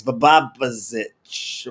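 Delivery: "Babazich" sounded out very slowly; tentative pronunciation. Real, not acted. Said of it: "I always pronounce his last name wrong".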